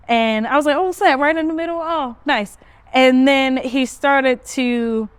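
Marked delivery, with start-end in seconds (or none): 0.8-2.6 higher, louder, quick reaction; mild acted surprise. Reacting to the customer's drawn target, mildly surprised. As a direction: pitch it up, pleasantly surprised.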